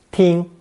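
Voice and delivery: "ting" held high and level, Mandarin first tone. Shown as a demonstration. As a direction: pitch "ting" high with no rise or fall. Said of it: "also pronounced first tone".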